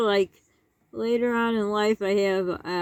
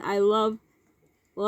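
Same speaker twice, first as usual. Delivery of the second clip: normal talking voice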